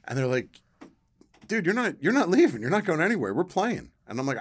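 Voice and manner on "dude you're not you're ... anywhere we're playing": loud, high-pitched imitation of bossy kids; bossy protest. Older kids to the young kid who wants to leave, bossy protest. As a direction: protest like older kids keeping a playmate from leaving.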